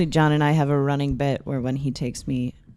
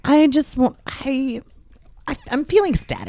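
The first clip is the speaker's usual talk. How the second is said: higher, airy ditzy voice; ditzy wife; 10 semitones higher than usual. Acted. Wife rubbing her hair, higher ditzy voice. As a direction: play the spaced-out wife. Wife acts dazed, voice raised.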